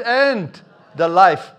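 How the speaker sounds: slow, loud, stretched out on the life; emphasis on the life. Retold or imitated. Preacher quoting Jesus to the congregation, stressing the life slowly. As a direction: lean hard into the life, drawing it out. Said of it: "Let's put the emphasis on the life".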